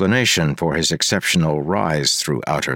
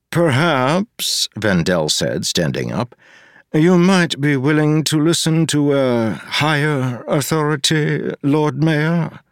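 eerie kandra; 10 semitones higher, slower than usual. Acted. Vendel warning the mayor about a higher authority, high and eerie. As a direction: speak as a being above mortals.

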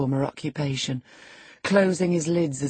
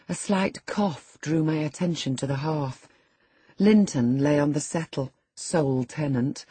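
plain reading voice

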